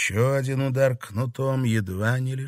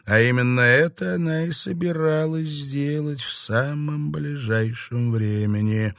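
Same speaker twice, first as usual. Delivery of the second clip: ordinary delivery, nothing marked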